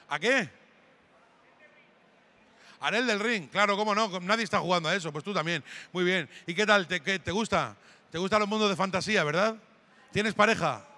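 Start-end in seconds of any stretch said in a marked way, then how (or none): none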